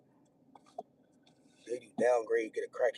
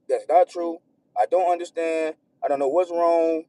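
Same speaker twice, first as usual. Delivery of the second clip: loud, raised, exasperated venting; real frustration; 6 semitones higher, 14 dB louder than usual. Real, not acted. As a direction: argue your case, pitched up and loud. Worked up recalling arguments with partner.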